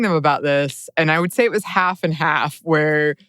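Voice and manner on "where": ordinary talk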